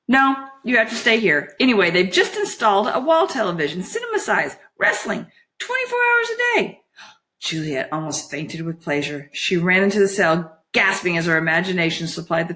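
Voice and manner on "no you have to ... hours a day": high-pitched, building sales pitch; coaxing fairy voice, rising high; Holly, enticing. Acted. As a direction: tempt her with growing excitement.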